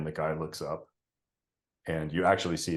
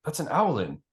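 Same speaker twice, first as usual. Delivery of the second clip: higher, quick voice of a second archer; another archer; 4 semitones higher than usual. Acted. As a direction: point it out to your partner, a bit higher. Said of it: "a different voice say".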